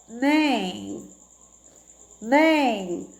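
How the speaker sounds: drawn-out "Nem" with the nose held; nose pinched. Shown as a demonstration. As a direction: say "Nem" slowly through a blocked nose. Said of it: "tampa o nariz e fala nem".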